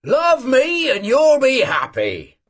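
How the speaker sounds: high, slow teasing call; shout. Acted. Old Cossack shouts after the girl walking past, slow and high. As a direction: stretch it out to reach the girl. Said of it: "shouted Yeroshka".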